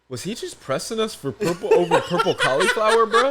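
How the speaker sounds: barely holding it together; Kai laughing. Retold or imitated. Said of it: "looks at me and goes".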